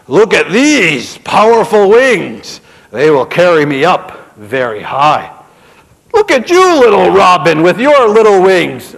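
loud, mocking, puffed-up brag; boastful eagle. A narrator's reading. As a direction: sneer at the small birds.

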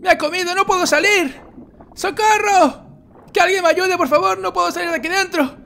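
mock panic. Gamer eaten by the dragon, shrieking high for help. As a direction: play being swallowed alive, screaming for rescue.

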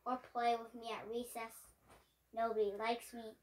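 plain reading voice

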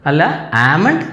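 ordinary delivery

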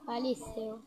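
ordinary delivery

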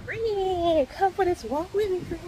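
high and loud, talking over the outdoors; outdoor walking vlog. Real, not acted. Vlogger greeting viewers on her morning walk, loud and high. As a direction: call it out to the camera while walking.

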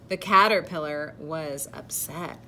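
animated read-aloud with deliberate emphasis; picture-book narration. A narrator's reading. Reader telling children of a proud caterpillar, storybook narration. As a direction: read to children, projecting key words.

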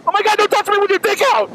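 high, fast panicked yell; a grown man yelping in a locker room; freaked-out shout. Retold or imitated. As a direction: shriek it like you're losing it. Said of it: "I flipped out".

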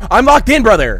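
high, loud yell, falling at the end; panicked yell. Real, not acted. Player telling brother they're locked in, panicked yell. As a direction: yell it out in alarm. Trapped in the game.

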